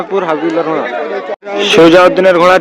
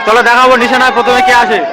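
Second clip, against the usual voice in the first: ordinary talk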